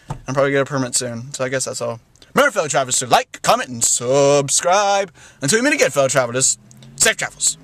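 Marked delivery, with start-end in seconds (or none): 2.3-7.6 a lightly grand outro voice; vlog sign-off. Acted. Host to fellow travelers, grand sign-off. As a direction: close the video like a host.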